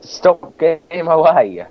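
high, loud angry yell; raging gamer. Real, not acted. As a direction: yell at him to move. Two players arguing over ladder.

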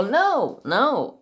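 high, loud protest; alarmed refusal. Retold or imitated. Patient refusing to let the doctor into the brain, protesting.

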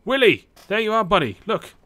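loud, high hail to a buddy; calling out. Real, not acted. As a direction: call out as you spot your friend. Calling out to game character.

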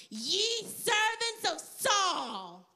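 loud, slow, stretched-out proclamation; booming challenge. Acted. Giant taunting Saul's army, loud and drawn out. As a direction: call out grandly across a valley. Goliath arrives calling out to the army.

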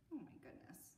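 usual speaking voice

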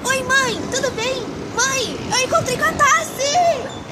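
high, bubbly rush of news; excited phone call. Retold or imitated. Daughter calling her mom, bursting with excitement. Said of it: "gritei para o mundo inteiro".

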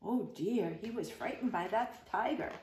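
plain reading voice

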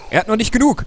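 "genug" hoch und laut wiederholt; high dramatic repeat. Shown as a demonstration. (hoch und dramatisch wiederholt)

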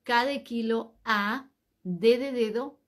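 ordinary delivery

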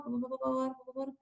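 very soft, fading repetition; murmured thinking aloud. Real, not acted. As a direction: trail down to a murmur while deciding. Thinking aloud, choosing who starts.

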